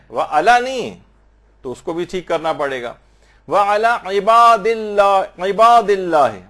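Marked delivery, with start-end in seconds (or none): 3.5-5.4 عِبَادِ with a strong throaty ع, loud and careful; clear ain sound. Shown as a demonstration. Teacher showing students the ع in عِبَادِ clearly. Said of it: "عین کی آواز نکلنی چاہیے". 5.4-6.4 عِبَادِ with a strong throaty ع, loud and careful; clear ain sound. Shown as a demonstration. Teacher showing students the ع in عِبَادِ clearly. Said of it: "عین کی آواز نکلنی چاہیے".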